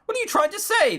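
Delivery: high-pitched, acted indignation; indignant challenge. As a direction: throw the question back in high-pitched disbelief. Acted quarrel between exes.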